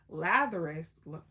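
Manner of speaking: ordinary delivery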